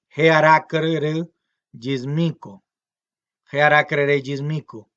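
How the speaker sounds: slow, careful teacher dictation; slow model sentence. Shown as a demonstration. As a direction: dictate Jearacrere yismico for the class to repeat. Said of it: "Esa mujer es mi abuela".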